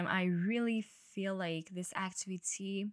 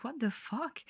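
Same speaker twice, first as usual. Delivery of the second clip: higher, mildly shocked disbelief; retold shock; 4 semitones higher than usual. Skater seeing friend skate perfectly, shocked. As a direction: react in mock shock, pitch lifted. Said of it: "I was shocked".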